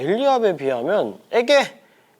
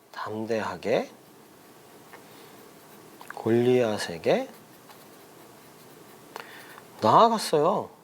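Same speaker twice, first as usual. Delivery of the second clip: low, soft 담대하게, long pause, 골리앗에게 drawn out and rising, long pause, 나아갔어요; dictation; 7 semitones lower, 6 dB quieter than usual. Shown as a demonstration. Repeats answer slowly for children writing. (담대하게, 골리앗에게, 나아갔어요를 길게 끊어 받아쓰기처럼)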